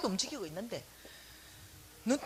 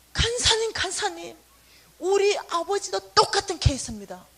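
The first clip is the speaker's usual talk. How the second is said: much higher, louder eager cry; eager call; 7 semitones higher, 6 dB louder than usual. Retold or imitated. Pastor's wife calling out to the speaker, eager and high.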